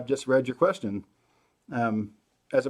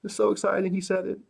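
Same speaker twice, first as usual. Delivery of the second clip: giddy; 7 semitones higher than usual. Retold or imitated. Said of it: "My wife and I just were both like".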